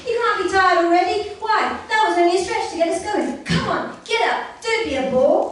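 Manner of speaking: high, loud, bouncy pig coaxing; piggy voice. A narrator's reading. Pig nagging brother to play, high loud. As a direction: play Oinky nagging his brother to get up.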